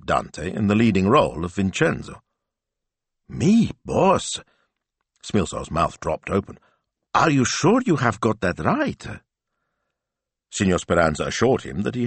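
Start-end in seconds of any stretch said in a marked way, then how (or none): 3.3-4.4 high, astonished question; surprised. Acted. Smilzo stunned at news. 7.1-9.0 high, disbelieving question; surprised. Acted. Smilzo stunned at news.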